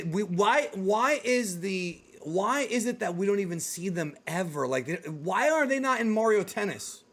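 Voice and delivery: normal talking voice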